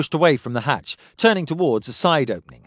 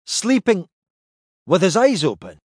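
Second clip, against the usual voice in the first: higher, incredulous repeat; disbelieving; 6 semitones higher, 3 dB louder than usual. A narrator's reading. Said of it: "He was sleeping".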